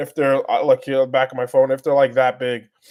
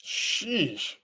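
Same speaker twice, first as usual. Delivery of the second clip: awed sheesh; 5 semitones higher, 8 dB quieter than usual. Real, not acted. Awed reaction.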